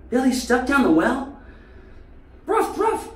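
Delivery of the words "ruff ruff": high mock bark; dog barking. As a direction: yap out the message like Lassie. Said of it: "more like that lassie".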